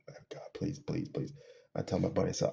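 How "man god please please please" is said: hushed mock plea to God; retold pleading. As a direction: plead softly under your breath, as if retelling a prayer. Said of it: "I start calling out to God".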